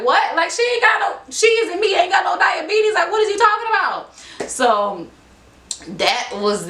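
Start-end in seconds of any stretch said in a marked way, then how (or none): 0.0-4.5 very high-pitched indignant protest; retold disbelief. Mom and daughter rejecting diabetes news, retold outraged disbelief. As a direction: climb way up high, protesting incredulously.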